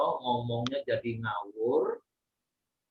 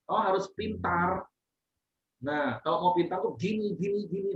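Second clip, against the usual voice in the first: high-pitched, insistent demonstration of correcting; firm rebuke; 7 semitones higher than usual. Shown as a demonstration. Leader showing someone how to be smart, firm rebuke. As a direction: rebuke firmly in a raised tone, without anger. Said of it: "itu menegur dengan keras".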